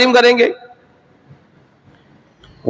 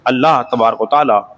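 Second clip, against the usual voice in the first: closing prayer; 8 semitones lower than usual. Real, not acted. Closing prayer after sermon. (धीमी, नरम आवाज़ में दुआ)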